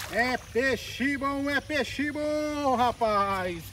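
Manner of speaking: long, stretched-out fishing call; drawn-out catchphrase. Real, not acted. Fisherman celebrating a hooked fish, drawn-out call. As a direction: stretch the last word out slowly.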